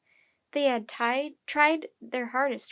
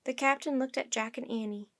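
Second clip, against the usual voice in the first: ordinary delivery, nothing marked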